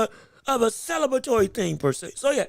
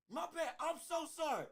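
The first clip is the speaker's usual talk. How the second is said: ordinary talk, nothing marked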